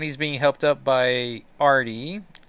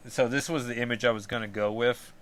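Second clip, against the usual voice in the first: ordinary talk, nothing marked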